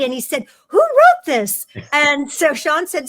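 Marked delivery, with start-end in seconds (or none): 0.7-1.7 high, loud burst of excitement; delighted producer. Retold or imitated. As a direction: imitate an overjoyed boss demanding the writer's name. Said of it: "Avi was so happy".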